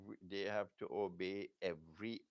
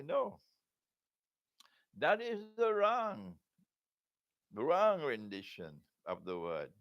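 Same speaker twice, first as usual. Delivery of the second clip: emphatic objection; 6 semitones higher, 7 dB louder than usual. Acted. Voices his side's emphatic objection.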